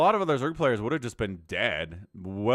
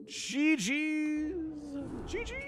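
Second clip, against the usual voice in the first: sing-song; 12 semitones higher, 5 dB quieter, much slower than usual. Pretend. Caster signs off match.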